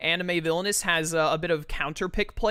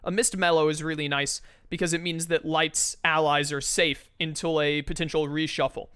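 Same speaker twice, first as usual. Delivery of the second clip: usual speaking voice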